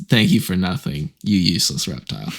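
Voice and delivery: low, flat, polite monotone dismissal; flight attendant delivery. Retold or imitated. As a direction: deliver the insult like a cheerful safety briefing. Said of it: "he says it like he's a flight attendant".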